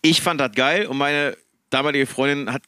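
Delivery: usual speaking voice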